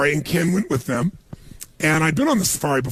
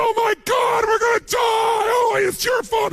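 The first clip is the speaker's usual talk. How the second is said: panicked scream. Retold or imitated. Tourist screaming at the guide in the stalled truck, panicked shout. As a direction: yell it like the lions are closing in. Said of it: "He's screaming at the other guy".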